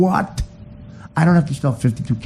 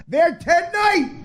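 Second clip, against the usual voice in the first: much higher, slow put-on voice; mock snooty voice; slower than usual. Comic mocking the rich as Caddyshack's Ted Knight. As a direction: sneer it like a stuffy club snob.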